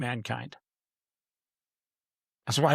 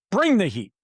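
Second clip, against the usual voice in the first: louder, high-pitched hype call; hype catchphrase; 5 semitones higher, 5 dB louder than usual. Pretend. Guest hyping up his own hot takes, playful hype. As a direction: punch it out high, falling away.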